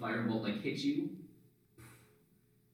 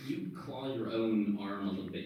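normal talking voice

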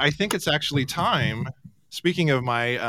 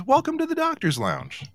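sign read; 13 semitones higher, 3 dB quieter than usual. Acted. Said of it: "it would have been funnier if I read it".

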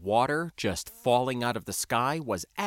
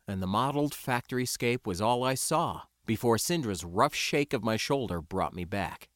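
plain reading voice

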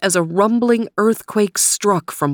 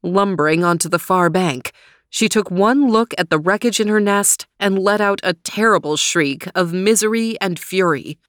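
ordinary delivery

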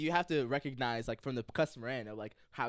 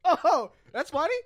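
loud, slow, high sarcastic reply; louder, higher, slow biting sarcasm; 13 semitones higher, 10 dB louder, slower than usual. Retold or imitated. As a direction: sneer it sarcastically at the driver. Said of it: "I go".

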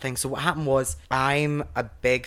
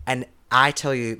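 ordinary delivery

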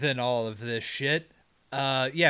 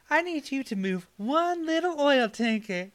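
put-on much higher, cooing little-girl plea; really high-pitched put-on voice; 12 semitones higher than usual. Lorelei asking Superman to move a tanker, high put-on voice. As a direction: pitch it way up, baby-talk style. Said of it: "I don't know why she has this accent".

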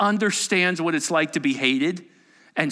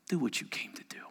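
whisper; 5 semitones lower, 12 dB quieter, faster than usual. Acted. Jesus answers Judas, retold again.

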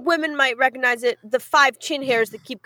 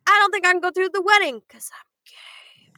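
a whispered confession; mock whisper; 6 semitones higher than usual. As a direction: breathe the secret out, unvoiced. Bride confessing to fiancé.

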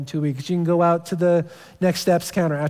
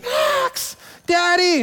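put-on child screaming; 13 semitones higher, much slower than usual. Daughter screaming for daddy, a high childish cry. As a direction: mimic a little girl yelling for her father.

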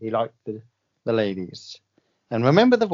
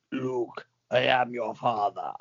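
heavy breath, then low, measured villain voice; Darth Vader; 4 semitones lower, 7 dB quieter, slower than usual. Acted. Medium channels a film villain for the guesser, low breathy impression. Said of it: "Is it David Prowse?".

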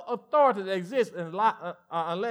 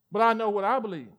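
ordinary delivery

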